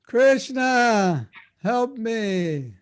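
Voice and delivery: high, long-drawn cry for help; drawn-out plea. Acted. Calling on Krishna to escape the trap, drawn-out pleading. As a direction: call out slowly, pitched well up, falling away.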